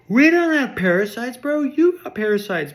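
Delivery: indignant dwarves. Pretend. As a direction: shout back, offended. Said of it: "they're like".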